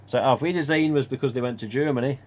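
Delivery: normal talking voice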